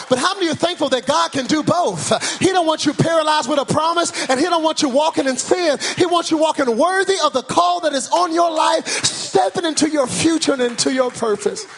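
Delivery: rally the church in one rolling build; full-force preaching. Real, not acted. Preacher urging the congregation to walk worthy, full-force preaching.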